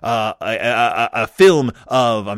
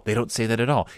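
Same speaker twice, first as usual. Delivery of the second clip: ordinary delivery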